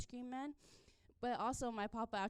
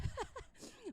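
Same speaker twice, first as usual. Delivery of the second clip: breathy laugh; 13 semitones higher, 6 dB quieter than usual. Real, not acted. Laughing after story.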